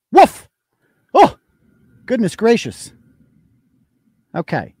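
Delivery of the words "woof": loud high woof; dog bark. Pretend.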